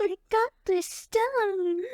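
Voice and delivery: mock villain voice. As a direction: ham up a villain boast. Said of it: "that's so bad".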